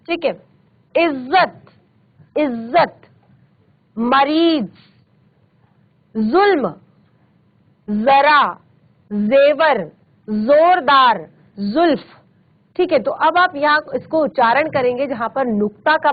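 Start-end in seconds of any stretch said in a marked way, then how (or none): 1.0-3.0 इज्जत with a long buzzing zz; vibrating z sound. Shown as a demonstration. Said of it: "ज में आपको एक वाइब्रेट". 4.0-12.0 each ज in मरीज, जुल्म, जरा, जेवर, जोरदार, जुल्फ as z; vibrating z sound. Shown as a demonstration. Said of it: "vibrate कर रहा है".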